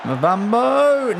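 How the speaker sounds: high, slow, showy drawn-out Mavambo; fancy pronunciation. Pretend. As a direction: pronounce Mavambo with exaggerated flair, stretching each syllable. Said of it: "Don't be so damn fancy".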